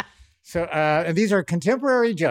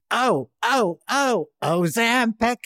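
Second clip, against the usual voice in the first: Joan Rivers impression; 6 semitones higher, slower than usual. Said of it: "I was doing like".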